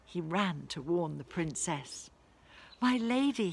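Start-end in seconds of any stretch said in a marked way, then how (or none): 2.8-3.5 breathless, higher and louder cry of "my lady"; puffing, out of breath. A narrator's reading. Drover out of breath after running to warn the princess. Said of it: "he puffed".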